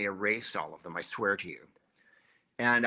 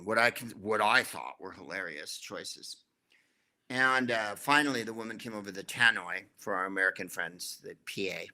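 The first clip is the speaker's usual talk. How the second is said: ordinary talk, nothing marked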